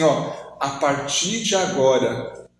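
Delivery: slow, low, deliberate declaration; solemn retold vow. Tearful woman vowing to become new, slow and solemn. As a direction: spell out the resolve phrase by phrase.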